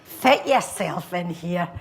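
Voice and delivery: slow, broad Glaswegian patter; swaggering Glaswegian, words drawn out; Glaswegian accent. Acted. Guy sweeps girl onto dance floor, Glaswegian accent. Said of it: "I go into the accent".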